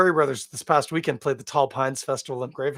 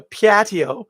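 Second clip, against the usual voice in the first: higher, louder Upper Peninsula Michigan 'Patio' with a rising tune; Upper Peninsula accent; 7 semitones higher, 6 dB louder than usual. Shown as a demonstration. Vlogger drifting into a Yooper accent saying 'Patio'. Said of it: "talk like I'm from the U P".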